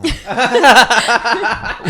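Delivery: high, loud cackle; bursting out laughing; big high laugh; loud burst of laughter. Real, not acted. Laughing at own story.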